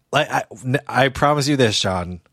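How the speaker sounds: normal talking voice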